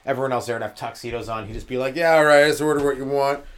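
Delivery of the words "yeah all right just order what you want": loud, easy big-spender voice; lightly voiced boss treating his employee; generous boss. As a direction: wave it off like the bill is nothing. Old boss voiced at dinner.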